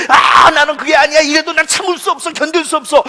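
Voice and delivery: loud, high stubborn protest; mock self-righteous outburst. Proud man refusing family's pleas, shouting protest. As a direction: blurt it out like a man who won't be stopped.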